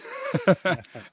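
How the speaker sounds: short real laugh at himself; embarrassed laugh. Real, not acted. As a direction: laugh it off, a little sheepish. Laughs at own accent slip.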